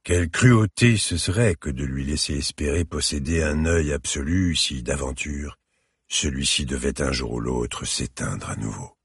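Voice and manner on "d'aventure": usual speaking voice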